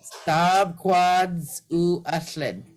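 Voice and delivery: very slow, each word apart; very slow model. Shown as a demonstration. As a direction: spell it out slowly for learners. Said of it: "Anyone just try that next line?".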